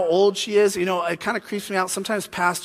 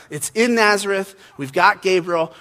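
usual speaking voice

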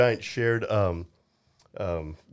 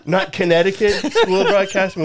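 laughing; 6 semitones higher, 5 dB louder than usual. Real, not acted. Joking about their broadcasting school.

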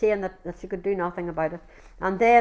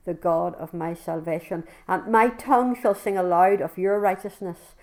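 ordinary talk, nothing marked

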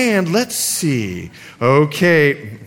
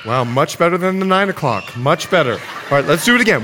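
usual speaking voice

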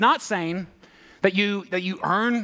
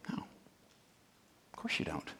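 breathy whispered mock reassurance; sarcastic whisper; 13 semitones lower, 15 dB quieter, much slower than usual. Preacher mocking the listener's excuse, sarcastic whisper. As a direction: whisper it like a sly false comfort. Mock answer to own question.

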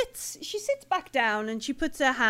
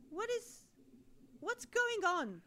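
bewildered woman; 7 semitones higher, 6 dB quieter than usual. Acted. Game master voices questioning woman.

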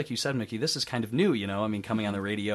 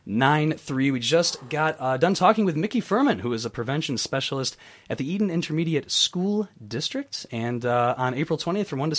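radio announcer; 6 semitones higher, 5 dB louder than usual. Acted. Host station ID, radio announcer.

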